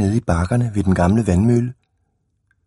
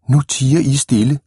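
stern teacher, higher and sharper; angry; 5 semitones higher, 3 dB louder than usual. A narrator's reading. Red-haired teacher silencing the children, mildly angry. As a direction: scold the class to silence. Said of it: "så meget vred ud".

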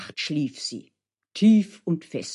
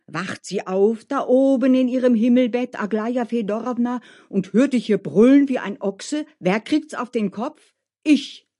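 higher, louder, indignant cook; scolding cook Mascha; 6 semitones higher, 6 dB louder than usual. Acted. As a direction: scold the noisy singer in rising alarm. (schimpfende Köchin, aufgebracht)

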